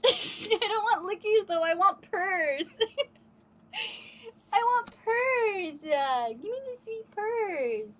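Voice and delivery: high, slow mock-whining plea for purrs; whiny baby talk. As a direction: beg the cat like a pouting toddler, laughing.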